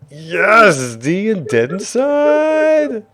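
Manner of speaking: very high, loud, drawn-out cheer; celebratory shout. Real, not acted. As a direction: hail the winner with a long yell. Celebrating the winner's name.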